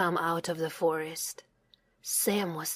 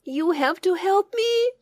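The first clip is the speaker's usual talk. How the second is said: begging; 10 semitones higher, 4 dB louder than usual. A narrator's reading. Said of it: "Sam begged them".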